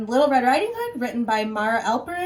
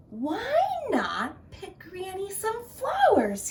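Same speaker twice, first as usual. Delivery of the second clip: sly wolf; 10 semitones higher than usual. Acted. Wolf tricking Little Red.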